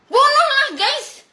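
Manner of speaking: high, loud defiant dare; defiant challenge. Real, not acted. Daring threateners to kill her.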